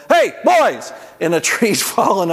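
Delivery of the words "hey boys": high, loud shout across the lot; tree farm owner yelling at boys; yelled hey. Retold or imitated. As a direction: call out to stop the running boys. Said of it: "the guy that owns the place yell".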